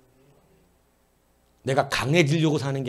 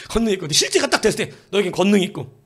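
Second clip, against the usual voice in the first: laughing through the words; 6 semitones higher, 7 dB louder, faster than usual. Real, not acted. Pastor laughs while quoting the promise to the church. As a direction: chuckle while saying it.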